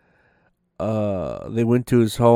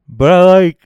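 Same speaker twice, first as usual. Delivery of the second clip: words stretched by a big yawn; 8 semitones higher, 11 dB louder than usual. Real, not acted. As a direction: talk through a yawn. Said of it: "I'm sorry I'm yawning so much".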